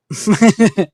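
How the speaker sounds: short loud burst of laughter. Real, not acted. Laughing out loud at a word typed by mistake.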